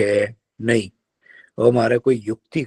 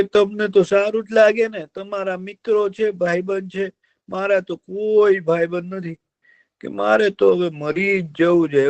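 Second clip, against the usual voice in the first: whining fox; 8 semitones higher than usual. Acted. Fox tells the deer he wants to die, whining. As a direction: moan like a fox faking misery.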